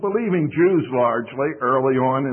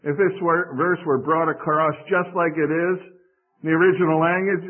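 ordinary talk, nothing marked

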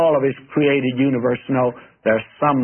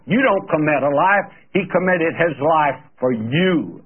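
ordinary talk, nothing marked

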